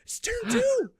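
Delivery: outraged squeak. Retold or imitated. Player shocked the enemy built a helicopter, high squeaky outrage. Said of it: "I'm like".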